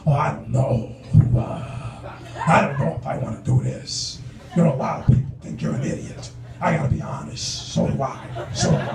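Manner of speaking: low, hesitant Al Pacino mumble; mumbling Al Pacino. Retold or imitated. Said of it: "He'd be like".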